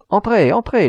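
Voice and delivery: a joyful welcome, pitched high; beaming with joy. A narrator's reading. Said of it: "rayonnant de bonheur". (accueil rayonnant de bonheur)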